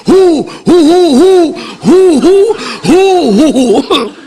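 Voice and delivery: owl-like who who; mock owl hoot. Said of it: "Shakespeare, il a écrit".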